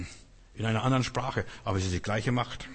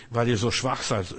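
ordinary delivery

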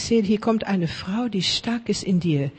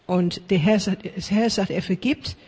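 ordinary delivery

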